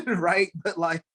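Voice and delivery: trying to talk while cracking up; can't hold back the laugh. Real, not acted. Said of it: "sorry for laughing".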